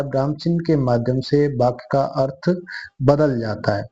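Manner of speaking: ordinary talk, nothing marked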